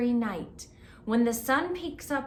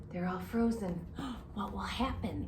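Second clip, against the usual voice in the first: usual speaking voice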